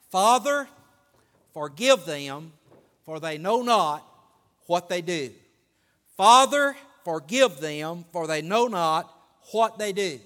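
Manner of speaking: preaching. Real, not acted. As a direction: proclaim each phrase, pausing between.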